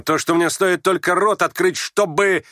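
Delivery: high heated cry, broken off; yelling. Acted. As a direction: yell the threat and stop short. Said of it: "завопил вдруг Ганя и не договорил". (вопль, оборванный на полуслове)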